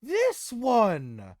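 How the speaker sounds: high, loud, grand character voice; Tyrael recognizing him. Acted. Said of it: "As Tyrael looks to him and says".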